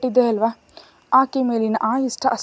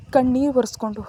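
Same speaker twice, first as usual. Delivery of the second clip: ordinary delivery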